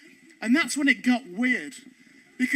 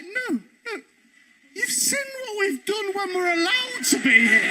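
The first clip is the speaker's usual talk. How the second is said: higher, raised protest; mock panicked objection; alarmed protest; 7 semitones higher than usual. Comedian refuses her plan in a raised panicked voice. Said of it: "And I was like".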